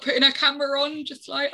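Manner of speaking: ordinary delivery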